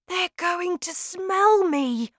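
put-on high, anxious boy's thought; worried boy voice. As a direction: fret like a scared little boy.

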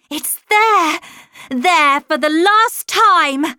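loud, high, insistent cry; urgent cry. A narrator's reading. Governess showing Miles the horror at the window, urgent cry. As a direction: cry it out urgently, slowing at the end.

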